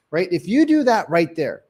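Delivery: ordinary delivery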